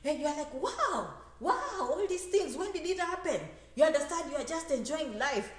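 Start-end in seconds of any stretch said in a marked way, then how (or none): 0.5-3.4 mock amazement. Amazed at changed homeland.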